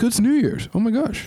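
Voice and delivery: high startled gasp of realization; surprised. Retold or imitated. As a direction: suddenly realize it's New Year's. Mock panic at New Year's.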